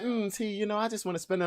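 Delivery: soft, pleading mock voice of the ex. The ex sliding in, mocked in a soft wheedling voice. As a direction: put on a wheedling voice. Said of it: "if they come over here talking that".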